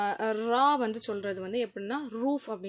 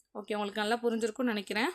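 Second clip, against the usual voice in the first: ordinary delivery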